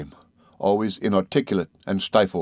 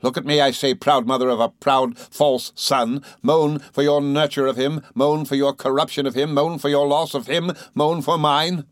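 furious accusation; 6 semitones higher than usual. Acted. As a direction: hurl it at the mother like a curse. Rosa attacking grieving mother.

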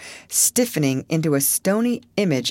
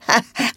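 small strange laugh; 8 semitones higher, 3 dB louder than usual. A narrator's reading. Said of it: "her small, strange laugh".

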